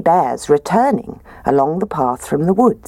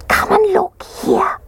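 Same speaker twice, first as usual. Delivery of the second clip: high, slow whispered little bear. Acted. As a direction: breathe it out in a tiny cub whisper. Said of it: "he whispered".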